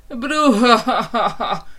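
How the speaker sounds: loud, slow jeering laugh; mocking laughing chorus. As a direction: guffaw at the wolf. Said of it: "a malackák gúnyos kórusa". (gúnyos hahotázás)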